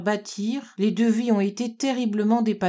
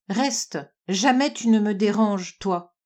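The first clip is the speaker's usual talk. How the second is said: ordinary delivery, nothing marked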